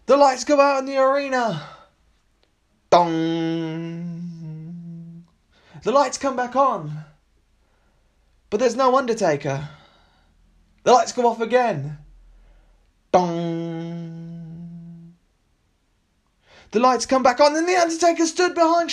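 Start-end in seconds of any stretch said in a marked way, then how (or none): 2.9-4.2 imitated gong. Undertaker's entrance gong. 13.1-15.1 imitated gong. Undertaker's entrance gong.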